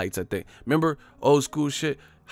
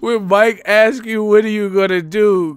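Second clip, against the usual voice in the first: louder, higher, slow words through laughter; laughing while talking; 6 semitones higher, 5 dB louder than usual. Real, not acted. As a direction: talk while still cracking up. Talks through his laughter.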